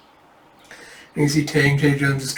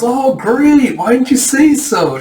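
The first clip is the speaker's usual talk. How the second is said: high, loud fake delight; sarcastic cheer before the put-down; mock enthusiasm; 10 semitones higher, 6 dB louder, slower than usual. Condescending mock agreement.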